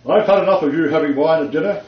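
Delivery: a mock stern God scolding over dinner wine; mock Monty Python God. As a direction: play a fed-up God from the Holy Grail, half laughing. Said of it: "not even in that voice".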